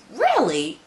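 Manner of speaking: high, loud incredulous reply; retold disbelief. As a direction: blurt it out in mock astonishment.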